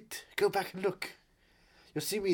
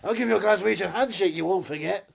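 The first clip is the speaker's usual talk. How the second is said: raised, louder Scottish accent; 4 semitones higher, 7 dB louder than usual. Shown as a demonstration. Showing off his Scottish with a threat, Scottish accent. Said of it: "that's more Scottish".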